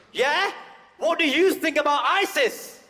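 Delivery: high, loud mock challenge; the man's question. The man in McDonald's baiting them, high loud mockery. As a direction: fire the question in a mocking voice. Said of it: "he followed up with this question".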